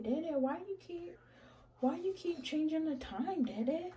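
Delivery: a hint of a five-year-old complaining to Dada; fed-up little daughter. Daughter asks Dada why he keeps changing the time, lightly whiny.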